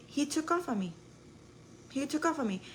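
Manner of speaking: alarmed retelling. Retold or imitated. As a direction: stress the shock of him running off. Retelling the boy running away.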